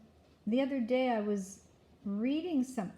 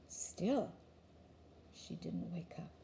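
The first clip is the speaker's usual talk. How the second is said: ordinary delivery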